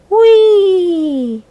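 long high falling whee; a delighted slide-away cry; gleeful whee. Acted. Mouse sliding away.

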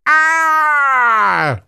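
high, loud yelp of pain; pained giant shout. A narrator's reading. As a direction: yelp as if your foot was bitten. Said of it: "cried the giant".

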